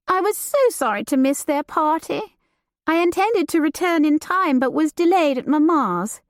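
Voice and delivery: light and teasing, a society lady; Judith, lively young lady. Acted. Judith teasing her cousin about the new servant, playful and high. Judith voiced beside narration.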